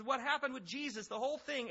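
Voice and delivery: normal talking voice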